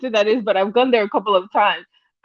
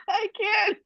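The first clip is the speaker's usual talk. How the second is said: high and much softer, barely out through laughter; laughing; 10 semitones higher, 10 dB quieter than usual. Real, not acted. Host can't cope with the viewer's name, wheezed out laughing.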